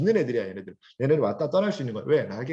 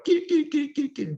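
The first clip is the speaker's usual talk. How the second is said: high, slow snickering cackle; a sneering little giggle; mocking snicker; 13 semitones higher, slower than usual. Shown as a demonstration. Teacher shows students the birds' mocking snicker. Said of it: "비웃는 거예요".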